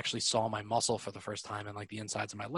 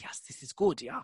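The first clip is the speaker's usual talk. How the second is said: mock German accent; 2 semitones higher than usual. Acting out listener charmed by calm German, mock German accent. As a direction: play a won-over listener in a German accent. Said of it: "they're talking to you like this".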